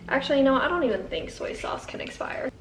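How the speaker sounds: hushed whisper aside. Real, not acted. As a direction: drop to a secret whisper. Hushed aside while cooking.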